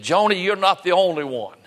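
imitating a friend's frustrated outburst; retold frustration. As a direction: plead in mild frustration, voice lifted high. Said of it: "almost in a sense of frustration".

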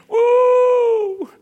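high, loud drawn-out "woo"; excited whoop. Real, not acted. Preacher rejoicing at the shepherds' excitement, loud high whoop. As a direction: let out a revival whoop.

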